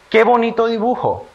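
high, admiring exclamation; exclamation of emotion. Shown as a demonstration. Teacher reads a sentence for children to classify, exclaiming high with admiration. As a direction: exclaim with delight, voice lifted high. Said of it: "se utilizan signos de admiración".

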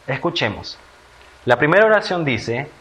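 ordinary talk

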